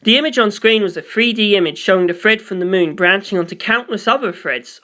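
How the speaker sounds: usual speaking voice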